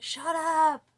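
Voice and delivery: sudden loud snapped "Shut up!"; yelling at myself. Retold or imitated. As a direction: yell it at the voices in your head. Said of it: "and I'll just be like".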